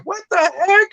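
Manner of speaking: very high, squeaky stunned exclamation; shocked. Retold or imitated. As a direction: pitch shooting way up in disbelief. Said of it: "And I was like".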